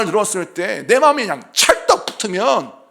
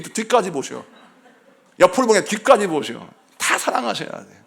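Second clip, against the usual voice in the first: ordinary talk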